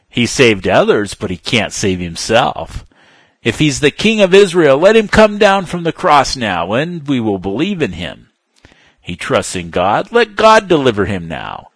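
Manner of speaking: loud, high scornful taunt; mocking priests. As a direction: throw the insult up at the cross. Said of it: "also mocking".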